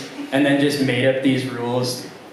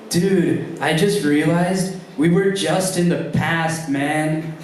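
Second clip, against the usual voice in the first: higher, dazed stoner drawl; stoned dude voice; 4 semitones higher, slower than usual. Retold or imitated. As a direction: sound amazed and fried. Stoned friend's musings.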